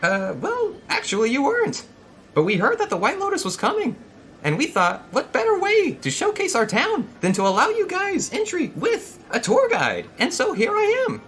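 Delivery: very high, loud tour-guide voice; proudly showcase the town in a strained falsetto; high-pitched, over-eager town greeter; Cap. Acted. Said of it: "let's see if I can do his voice".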